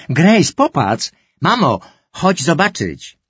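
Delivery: wysokie, głośne, wolno przeciągane wołanie; zawołaj wszystkich, bo znalazłeś coś wspaniałego; podekscytowane wołanie. Acted. Brother calls family to screen. (brat woła siostrę i mamę do ekranu, podekscytowany)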